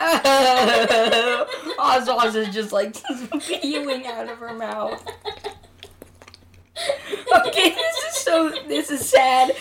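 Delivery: talking while laughing, high; giggling, can barely speak; laughing through words. Real, not acted. Laughs while describing gagging.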